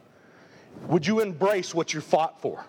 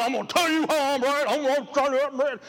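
a husband's mock insistence, pitched up; voice getting loud; 6 semitones higher than usual. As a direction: insist you're right, raising your voice in fun. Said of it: "voice gets loud".

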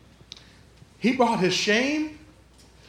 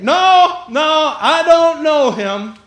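loud, high, drawn-out refusal; emphatic denial; 5 semitones higher, 7 dB louder, much slower than usual. Acted. Peter disowning Jesus to the crowd, loud, drawn-out denial. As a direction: deny it slowly and loudly.

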